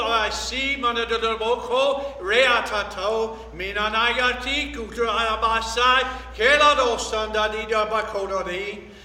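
ordinary delivery

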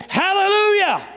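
high ringing shout of praise; shouted hallelujah. Real, not acted. Preacher to church, shouting hallelujah. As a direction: throw it up to the rafters, full voice.

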